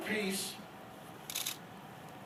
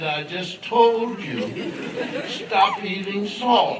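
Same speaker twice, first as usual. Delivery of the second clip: slow, louder, spelling it out; exasperated repeat; 3 semitones lower, 5 dB louder, slower than usual. Acted. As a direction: tell him again, patience wearing thin.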